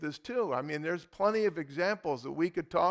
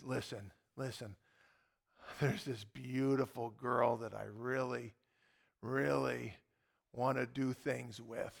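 confidential request; 5 semitones lower, 8 dB quieter than usual. Retold or imitated. Retold man's confidential request to preacher.

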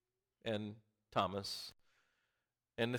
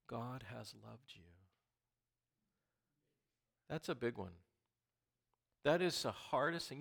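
ordinary delivery

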